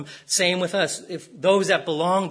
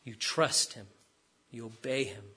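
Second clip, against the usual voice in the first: hushed earnest; 7 semitones lower, 11 dB quieter than usual. Acted. Pastor hushes a tender point.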